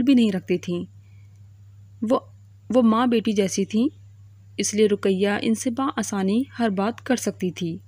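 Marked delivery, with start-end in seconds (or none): none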